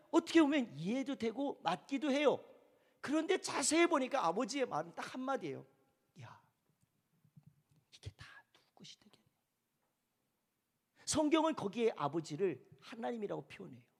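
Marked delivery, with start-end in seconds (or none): 6.2-9.2 very soft, quick whisper; barely audible, confiding question; hushed fatherly reply. Acted. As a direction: whisper it as a father's intimate answer. Father's gentle answer, acted.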